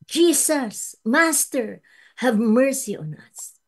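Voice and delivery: a loud, high plea for mercy; lepers crying out. Retold or imitated. Preacher voicing lepers begging Jesus, loud high plea. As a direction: cry out to the Master for help. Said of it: "Sabi nila".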